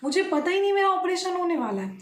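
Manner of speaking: mildly acted startled protest; shocked. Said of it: "मैं shock हो गई".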